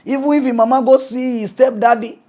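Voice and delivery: ordinary delivery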